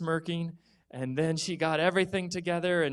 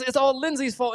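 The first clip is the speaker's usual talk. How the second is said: put-on blaming kid; 9 semitones higher, 6 dB louder than usual. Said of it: "Noah says".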